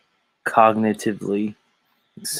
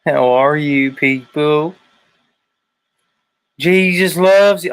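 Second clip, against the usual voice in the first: loud, high, hammed-up Southern evangelist; practiced preacher greeting; 5 semitones higher, 6 dB louder, slower than usual. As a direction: sing out the welcome like a mock televangelist. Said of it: "I'm practicing".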